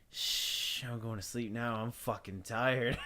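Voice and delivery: whispered shh, then soft, drowsy, slow words; sleepy shush. Retold or imitated. Telling girlfriend he's going to sleep, soft drowsy shush. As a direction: drift off mid-sentence.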